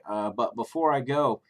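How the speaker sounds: ordinary talk, nothing marked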